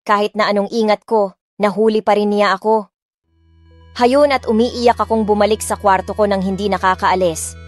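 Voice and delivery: plain reading voice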